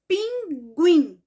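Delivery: "Pinguim" loud and high, stressing "guim"; teacher sounding out "Pinguim", strongest on "guim"; stress on last syllable. Shown as a demonstration. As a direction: split "Pinguim" in two and punch "guim". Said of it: "o pedacinho mais forte ou a sílaba mais forte".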